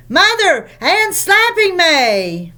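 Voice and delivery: high, slow whiny wail for mother; tattling child. Acted. A twin complaining to mother, whiny wail. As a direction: tell on her sister, whining.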